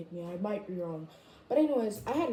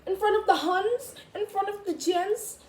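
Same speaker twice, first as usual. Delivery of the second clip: fast indignant protest; 8 semitones higher than usual. Retold or imitated. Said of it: "I was just like".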